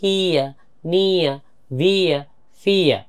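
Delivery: হিয়া, নিয়া, ভিয়া, ফিয়া each drawn out as a gliding "ee-uh"; sound drill. Shown as a demonstration. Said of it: "ইয়া দিয়ে কি হয়?". (হিয়া, নিয়া, ভিয়া, ফিয়া আলাদা করে টেনে "ইয়া" ধ্বনিতে শেষ)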